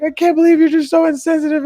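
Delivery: very high-pitched mock girlfriend voice; imitated upset girlfriend. Girlfriend scolding him over the runaway dog, imitated high-pitched upset. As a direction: mimic her hurt reproach way up high. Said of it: "She started crying".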